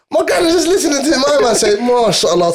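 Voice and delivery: high, mock-amazed old man voice; lightly voiced shocked granddad; imitating granddad. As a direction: do granddad, stunned at the song. Retold granddad hearing the tune.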